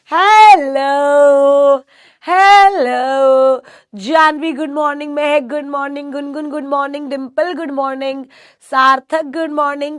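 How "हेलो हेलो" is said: very slow, loud stretched hello; long drawn hello. Real, not acted. Teacher calling out a long loud hello to the class.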